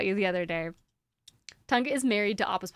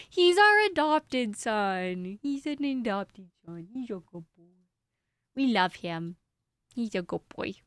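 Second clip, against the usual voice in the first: cooing; slower than usual. Real, not acted. Cooing about her dog.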